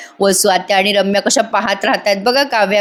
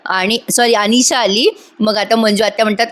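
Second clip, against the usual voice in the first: ordinary delivery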